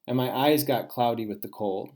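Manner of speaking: ordinary delivery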